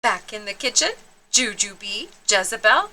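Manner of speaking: usual speaking voice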